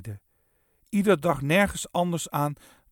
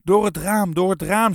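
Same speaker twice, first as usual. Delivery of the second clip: loud, raised urgent shout; shouted order; 4 semitones higher, 7 dB louder than usual. A narrator's reading. Eddy sending the musicians out the window, shouting. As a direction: shout the escape route to everyone. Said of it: "schreeuwde hij".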